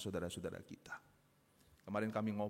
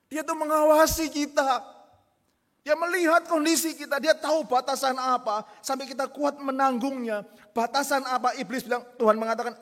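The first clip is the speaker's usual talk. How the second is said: fervent preaching; 14 semitones higher, 12 dB louder than usual. Acted. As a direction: preach it out to the whole hall. Preacher at full force.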